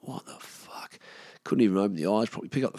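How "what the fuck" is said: hushed, half-asleep disbelief; barely voiced, bleary muttering; groggy whisper. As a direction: whisper it as if just woken hungover. Hungover, woken by ringing phone.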